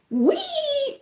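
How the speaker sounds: very high, long playful squeal; imitating a boy's bath-time glee; little boy's glee. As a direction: let out a child's delighted wheee. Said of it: "see this little boy come out".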